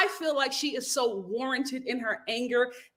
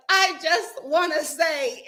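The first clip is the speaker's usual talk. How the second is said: slow, higher, mock-weepy plea; mock teary Tom; 5 semitones higher, slower than usual. Tom fake-crying as the reunion opens, mock weepy. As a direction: ham up a fake cry on the words. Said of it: "Tom starts to cry".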